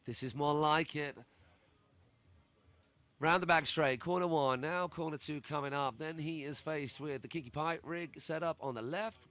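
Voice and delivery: pumped-up play-by-play call; tractor pull announcer. Acted. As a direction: call every trick like a monster truck rally. Hyped live trick play-by-play.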